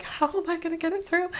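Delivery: high-pitched mock despair; struggling whine. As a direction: act out flagging halfway through a set. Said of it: "we're already struggling".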